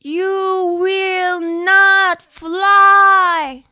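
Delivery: a towering voice flatly forbidding the bird to fly; slow booming refusal. Acted. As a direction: lay it down like a heavy decree. Another figure refuses the bird.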